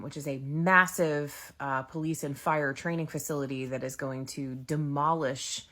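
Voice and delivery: ordinary delivery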